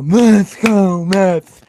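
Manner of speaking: loud, high-pitched mock fan chant; mocking imitation of chanting fans; imitated crowd yell. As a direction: yell it out like an obnoxious section of fans. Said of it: "everyone in my section just yell".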